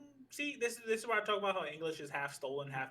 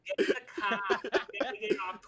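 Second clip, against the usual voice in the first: higher, hammed-up Long Islander with laughter; stretched Long Island "coffee"; mock Long Island accent; 4 semitones higher, 4 dB louder than usual. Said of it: "Like, Italian Long Islander".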